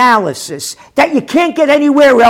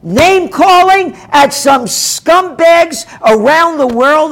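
incredulous angry retort; 5 semitones higher than usual. Real, not acted. Host throwing a viewer's word back, outraged. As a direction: scoff at the charge, outraged. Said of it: "You shouldn't resort to name-calling".

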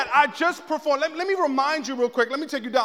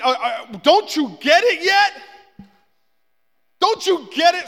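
emphatic plea; 6 semitones higher, 6 dB louder than usual. Acted. Jesus pressing disciples.

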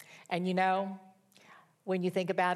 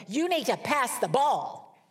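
a slow, louder, higher mock bossy kid; bossy little girl; 6 semitones higher, 5 dB louder than usual. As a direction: play the know-it-all teammate giving orders. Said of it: "a little girl comes up to her and said".

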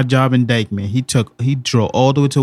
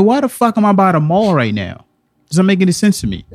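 higher, louder, baffled outburst; retold confusion; 8 semitones higher, 4 dB louder than usual. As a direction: relive the shock of waking up somewhere wrong. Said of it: "I'm like".

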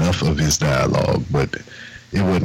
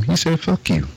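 pitched way up, mock innocent; high, incredulous retelling; 11 semitones higher than usual. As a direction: tell it like you can't believe it. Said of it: "And I was like".

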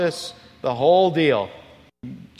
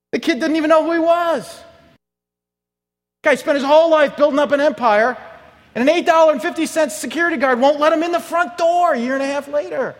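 high, loud outraged shout; preaching shout; 12 semitones higher, 6 dB louder than usual. Real, not acted. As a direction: hammer the injustice home.

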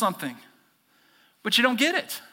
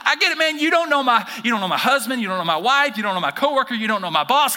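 louder, higher, exasperated listing; mock complaint; 6 semitones higher, 6 dB louder than usual. Pastor voicing a congregant venting at James, exasperated. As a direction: vent about everyone else. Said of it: "Some of y'all are like".

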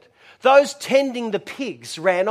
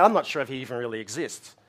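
doubting Thomas; 9 semitones lower, 6 dB quieter, faster than usual. Acted. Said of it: "He's probably going".

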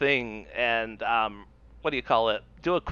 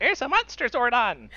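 high-pitched little robot voice; put-on robot voice, pitched up; Alpha Five; 9 semitones higher than usual. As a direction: imitate the alarmed cartoon robot. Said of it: "that little robot from Power Rangers".

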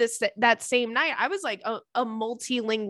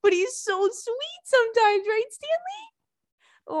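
very high, cooing voice; 12 semitones higher, slower than usual. Real, not acted. Podcaster to her cat Stanley, cooing baby talk. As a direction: coo sweetly, stretching out the cat's name.

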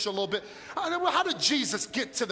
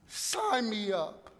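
usual speaking voice

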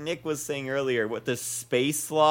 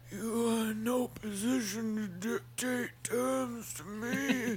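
villain voice; 7 semitones higher, 9 dB quieter than usual. Retold or imitated. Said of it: "And Baleem says".

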